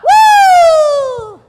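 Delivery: loud high whoop of triumph; excited whoop. Real, not acted. Coach cheers the crowd after they shout, whooping. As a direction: let out a big celebratory woo.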